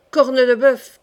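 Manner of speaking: loud, emphatic curse; exasperated oath. A narrator's reading. Coconas swears at his lovesick friend, loudly exasperated. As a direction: burst out with a swear.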